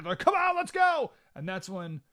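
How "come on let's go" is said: much higher, excited whoop; hammed-up eager rally cry; mock ecstatic cheer. Happy advisors urging an attack, mock ecstatic cheer. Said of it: "They're ecstatic".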